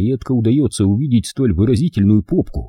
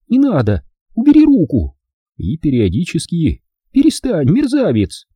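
put-on high, louder girl's cries; protesting girl; 8 semitones higher, 3 dB louder than usual. A narrator's reading. Hilda fends off Rick with high cries. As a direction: push him off in mock protest.

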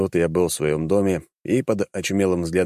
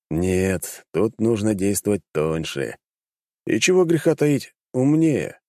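ordinary delivery

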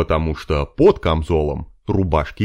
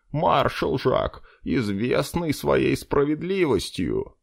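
higher, softer, slow «Маршал»; soft flattering elf; 7 semitones higher, 4 dB quieter, slower than usual. Acted. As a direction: repeat «Маршал» humbly and sweetly.

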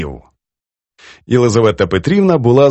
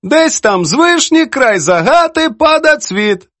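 slow, high poetic recitation; declaiming; 13 semitones higher, slower than usual. A narrator's reading. Poetess quotes editor's verse back at him, declaiming. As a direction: recite it grandly, stretching the line. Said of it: "І вона продекламувала".

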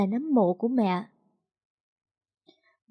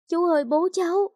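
higher, distraught call; anguished cry; 7 semitones higher, 3 dB louder than usual. A narrator's reading. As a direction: fear breaking through. Daughter fearing for her father. (hoảng hốt)

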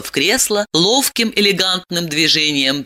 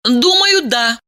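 put-on young woman voice; 7 semitones higher, 3 dB louder, slower than usual. Diana voice, high.

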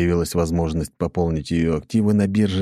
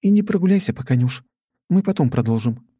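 put-on older sister voice; 8 semitones higher than usual. A narrator's reading. Older sister scolding younger.

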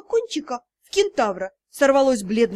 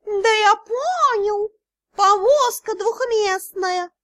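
high, slow cat drawl; smug cat spirit answering his friend; 8 semitones higher, much slower than usual. Acted. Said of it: "ответил дух золотого дракона".

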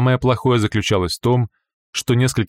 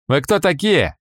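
suspicious guard; 7 semitones higher than usual. Acted. Guard challenges strangers.

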